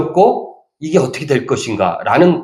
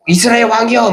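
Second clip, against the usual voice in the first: higher excited shout of the crowd; a hint of a crowd acclaiming a king; crowd's cry; 9 semitones higher, 3 dB louder than usual. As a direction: cry out the king's title. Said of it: "막 이러는 거예요".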